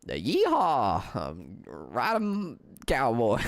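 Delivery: slow, drawn-out Southern cowboy call; half-hearted Southern yeehaw; Southern cowboy accent. Pretend. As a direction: drawl it like a weary Southern cowboy. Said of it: "I'm tired of being a southerner".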